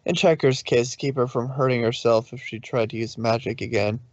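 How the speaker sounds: ordinary delivery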